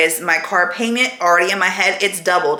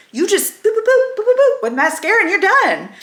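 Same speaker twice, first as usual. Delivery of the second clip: very high squeaky boops; giggly high mascara boops; playful boops; 13 semitones higher than usual. Retold or imitated. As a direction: chirp each boop up high. Mimics quick mascara strokes.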